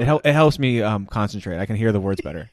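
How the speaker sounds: right up on the mic, very loud; too close to the mic, booming. Real, not acted. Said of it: "It's so loud in the mic".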